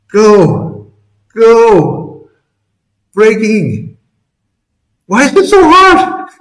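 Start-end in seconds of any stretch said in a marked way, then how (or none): 5.0-6.3 high, exasperated cry; frustrated outburst. Real, not acted. Frustrated shout at chart.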